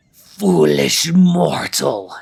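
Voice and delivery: slow menacing ghost snarl; dark lord. Acted. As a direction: snarl it slowly like a vengeful spirit. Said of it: "she snarls".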